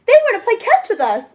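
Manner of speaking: high, bright delight; excited. A narrator's reading. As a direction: squeal it happily. Excited Nikki.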